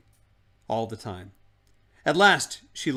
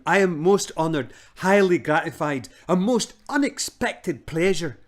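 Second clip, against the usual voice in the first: put-on flustered old man; 6 semitones higher, 8 dB louder than usual. Uncle Andrew flustered, fawning.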